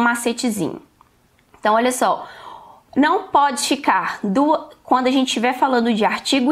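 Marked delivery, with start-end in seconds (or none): none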